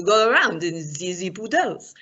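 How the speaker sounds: hammed-up French accent; cartoonish French accent; terrible French accent. Shown as a demonstration. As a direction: put on a badly faked French accent. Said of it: "my terrible French accent".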